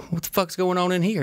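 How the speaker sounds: sudden high shocked question; startled dad. Retold or imitated. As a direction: burst in, caught off guard. Dad bursts into son's room.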